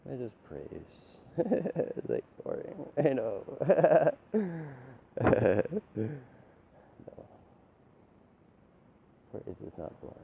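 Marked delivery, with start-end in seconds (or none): 3.5-6.2 rising laughter, a bit louder. Real, not acted.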